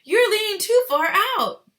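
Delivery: high, loud worried call; shout. Acted. Father shouting at son by the river. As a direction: warn him off the edge. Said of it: "shouted Mister Gloop".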